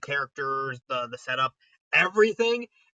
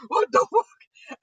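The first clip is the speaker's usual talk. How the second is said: rising, higher panic ending in a slow drawn-out cry; mock panic; 7 semitones higher, 5 dB louder than usual. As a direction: freak out as the truth sinks in. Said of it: "panicking over his realization".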